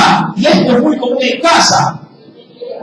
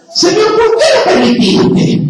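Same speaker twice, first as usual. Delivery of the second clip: complaining prayer; 11 semitones higher than usual. Retold or imitated. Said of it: "de manera santa la maldición".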